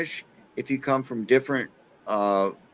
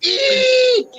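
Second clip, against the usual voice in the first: shrill mock Australian scream, much higher and louder; screaming Australian accent; 7 dB louder than usual. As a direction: shriek it like a scared Australian girl. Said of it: "screaming at the screen".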